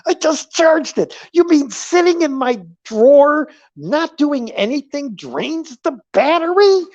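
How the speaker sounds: indignant, high loud outburst; pissed off. Retold or imitated. Streamer retells fuming over the dead camera battery, pissed off. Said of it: "was so pissed".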